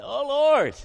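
high, loud mock wail to heaven; desperate cry out. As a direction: cry out as if backed into a corner. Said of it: "cry out to the Lord".

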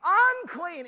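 high, loud cry of a leper. Retold or imitated. Leper on the road warning others, loud high cry. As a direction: call it out to warn the road. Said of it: "crying out".

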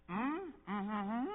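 soft strained mm-hmm over a grimace; hiding disgust at the table; forced polite mm. Retold or imitated. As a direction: pretend to like a bad bite. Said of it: "we're like".

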